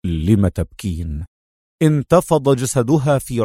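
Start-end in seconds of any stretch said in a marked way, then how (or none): 0.1-1.3 low, slow and gentle man's voice; soft tender question. A narrator's reading. Wounded man wakes and asks why she cries, low and tender. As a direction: ask softly, tenderly. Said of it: "سؤاله الخافت الدافئ".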